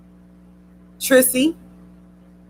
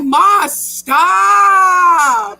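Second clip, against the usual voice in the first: louder, higher drawn-out whine; mock whiny son; 6 semitones higher, 6 dB louder than usual. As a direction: imitate a kid begging mom to quit. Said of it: "He be like".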